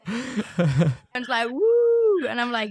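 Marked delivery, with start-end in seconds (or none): 1.5-2.3 loud, high, drawn-out "woo"; long high mock cheer; imitated whoop. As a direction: whoop like an excited street heckler. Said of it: "He's just like".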